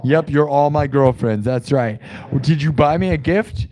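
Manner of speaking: usual speaking voice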